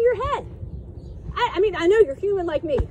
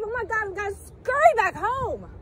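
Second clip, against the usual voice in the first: ordinary delivery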